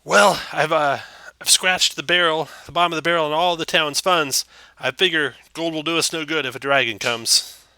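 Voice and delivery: anxious little man pleading from behind a door; nervous townmaster. Acted. GM voices Harbin the townmaster.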